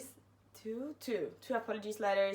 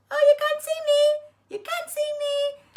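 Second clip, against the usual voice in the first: put-on very high, loud childish taunt; cartoonish sing-song mockery; mocking silly voice; 11 dB louder than usual. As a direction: squeal it like a kid playing hide and seek. Mocking friends hiding under desk.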